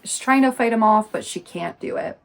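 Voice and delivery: ordinary delivery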